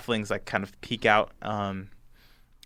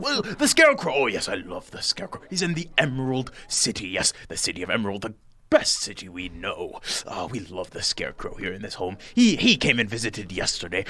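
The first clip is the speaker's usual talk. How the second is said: lightly voiced nervous host, fussing and apologetic; a flustered host invites the strangers in; fussy little host; 6 semitones higher than usual. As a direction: ramble politely, tripping over words. Bock on Scarecrow.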